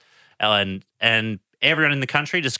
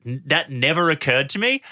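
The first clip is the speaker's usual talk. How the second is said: ordinary talk, nothing marked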